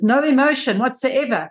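ordinary talk

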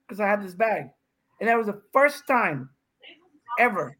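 normal talking voice

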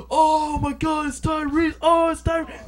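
high, loud excited hype shouting. Retold or imitated. Booth staff hyping [name] to the crowd, excited shouting. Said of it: "they actually had people at the booth saying".

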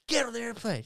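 high, loud mock order; mock urgent command. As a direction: bark it like a pushy friend. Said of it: "She was".

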